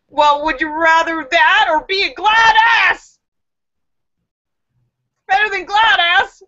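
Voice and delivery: put-on high, loud little girl voice; little girl punchline. Little girl explaining her name to the principal, high and loud. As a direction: deliver the punchline as a cheeky kid.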